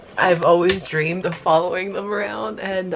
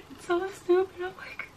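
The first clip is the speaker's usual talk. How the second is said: high, choked voice on the edge of tears; tearing up; 9 semitones higher, 6 dB quieter, faster than usual. Real, not acted. Telling viewers she is tearing up, choked and high. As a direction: fight back the tears as you speak. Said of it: "actually tearing up".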